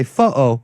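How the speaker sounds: "photo" said the British way, with the T dropped; British pronunciation. Shown as a demonstration. Showing Louis he can say "photo" in a British accent. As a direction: say "photo" like a Brit, swallowing the T. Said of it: "I know how to say photo".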